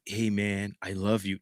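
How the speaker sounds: quiet, low, tender "hey man"; soft affectionate. Retold or imitated. Asking viewers about telling their homies, soft and tender. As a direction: hush your voice like telling a homie you love him. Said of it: "when the last time y'all said to y'all homies".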